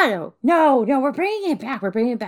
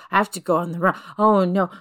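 usual speaking voice

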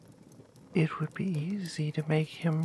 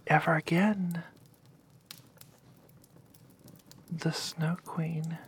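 whispered story. Acted.

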